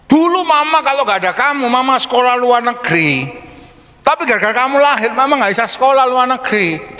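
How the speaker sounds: a hint of a bitter mom blaming her child; resentful mother. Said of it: "Jangan sampai orang tua ngomong".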